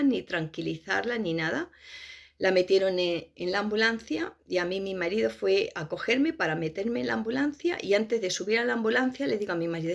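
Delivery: usual speaking voice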